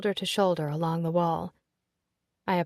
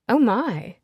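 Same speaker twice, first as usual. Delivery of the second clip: a higher, louder startled gasp of words; flustered surprise; 4 semitones higher, 6 dB louder than usual. Acted. Secretary meets an unexpected guest, flustered surprise. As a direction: caught off guard. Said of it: "she stutters".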